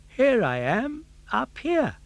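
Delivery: high piping cricket voice; talking cricket. Acted. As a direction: call down from the rafters, tiny and high. Cricket calls from the roof.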